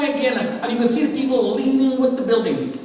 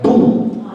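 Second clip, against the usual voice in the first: imitated booms. Imitating the tower collapsing floor by floor, breathy booms. Mimicking the impact on the church.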